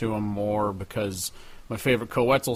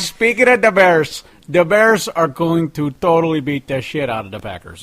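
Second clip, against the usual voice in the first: loud, higher mock Chicago sports-fan accent; trash-talk the Packers like a Chicago local; 6 semitones higher, 9 dB louder than usual. Cohost rants about the Bears in Chicago accent. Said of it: "We can do the accent".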